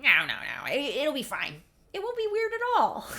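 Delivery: high, loud protest that all is fine; overdone reassurance. Retold or imitated. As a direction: brush off the worry too eagerly. Breezy reassurance, contradicted by aside.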